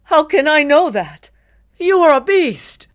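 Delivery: very high, loud accusing cry. A narrator's reading. Victor accusing the creature of being a beast. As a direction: hurl the accusation. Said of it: "accused Victor".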